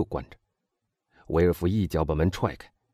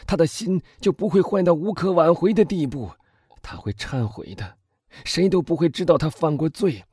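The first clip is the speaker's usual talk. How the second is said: agitated, higher-pitched reasoning with himself; distraught husband's inner monologue; 8 semitones higher than usual. A narrator's reading. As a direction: plead with yourself, swinging between hope and guilt. (激动不安的内心独白)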